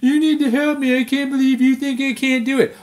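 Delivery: high, whiny mimic of his girlfriend; mock her nagging in a high whine. Mimicking his girlfriend's whining about the race, high and whiny. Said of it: "she was just fucking nagging".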